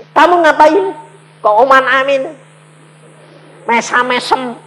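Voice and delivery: acted indignant telling-off; annoyed scolding. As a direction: confront a grinning heckler. Acted scolding of heckling young man.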